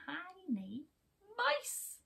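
high, squeaky reveal; tiny squeaky voice. A narrator's reading. Storyteller revealing the tiny mouse to children.

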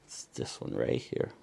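whispered aside. Real, not acted. Child pointing out the monument, whispering. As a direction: lean in and whisper it.

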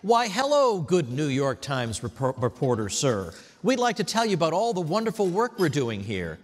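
mock fawning host greeting a New York Times reporter; fawning welcome. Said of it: "the red carpet would have been rolled out for this reporter".

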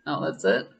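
ordinary delivery

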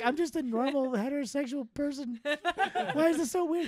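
laughing mock quote. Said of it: "She's like".

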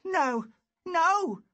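high frightened squeal; scared boy squealing at bully. Acted. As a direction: cry out as he covers his head. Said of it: "squealed Lou".

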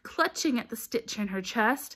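usual speaking voice

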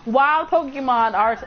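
ordinary talk

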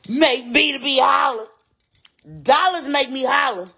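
high, loud, drawn-out holler; excited holler. Real, not acted. Streamer hollering to viewers about dollars. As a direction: holler it like money thrills you.

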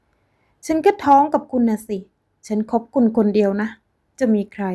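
hysterical scream. Acted. Linda screaming hysterically at Frank. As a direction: shriek like she has lost her mind. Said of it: "กรีดร้องราวกับเป็นคนเสียสติ".

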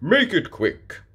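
gruff hurried request; Daddy Pig nervous of the rocket, gruff. A narrator's reading. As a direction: get it over with. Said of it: "Daddy Pig snorts".

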